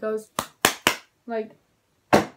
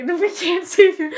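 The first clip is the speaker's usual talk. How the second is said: laughing; 8 semitones higher, 10 dB louder than usual. Retold or imitated. Leaving caught friend behind, laughing.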